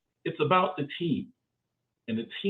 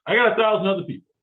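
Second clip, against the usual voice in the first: quick, louder, higher challenge; challenging interviewer; 5 semitones higher, 5 dB louder, faster than usual. Acted. Interviewer challenging a candidate on why you, brisk and louder.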